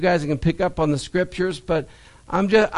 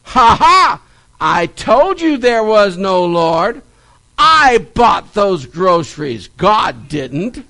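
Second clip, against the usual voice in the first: loud, slow triumphant gotcha; mock-triumphant jeer, hammering the words; gloating neighbour; 6 dB louder, slower than usual. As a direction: crow over catching someone out. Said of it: "neighbor jumped out from behind the bush".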